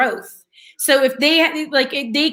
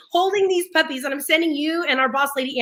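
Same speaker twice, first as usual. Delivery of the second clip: usual speaking voice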